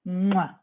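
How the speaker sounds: short smacked "mwah" kiss; blown kiss. Real, not acted. Host signing off to live viewers, blown kiss. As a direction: kiss the audience goodbye. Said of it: "Give me kisses".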